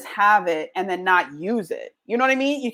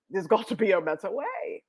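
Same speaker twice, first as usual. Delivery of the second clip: lightly put-on English accent, a bit softer; mock English accent; 5 dB quieter than usual. Voicing whoever first dreamed up a better way, mock English accent. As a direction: do a playful English voice. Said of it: "I like doing the English accent".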